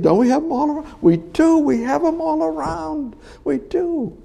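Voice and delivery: very high-pitched mocking voice; high, sing-out mock reply; mock churchgoer chatter. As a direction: send up the complacent churchgoers in a high voice.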